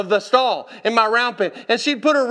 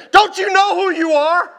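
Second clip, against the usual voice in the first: higher, louder exasperated yell; screamed; 8 semitones higher, 5 dB louder than usual. Retold or imitated. Frustrated owner screamed out to his horse, exasperated yell. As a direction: scream it out as a demanding question. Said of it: "I screamed out to her".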